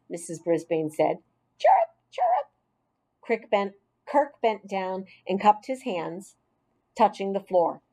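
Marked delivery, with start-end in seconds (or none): 1.6-2.5 a sharp, squeaky cricket chirrup; high cricket chirp. Acted. Boy hiding in class mimics a cricket, high squeaky chirp. Cricket noise made by Kirk.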